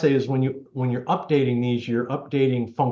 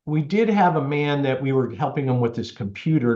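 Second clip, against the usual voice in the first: ordinary delivery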